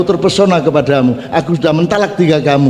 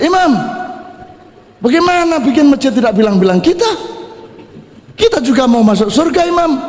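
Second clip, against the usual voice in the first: called out high, a mild mock yell; mock shouting; 8 semitones higher than usual. Businessmen calling to the imam, mild mock yell.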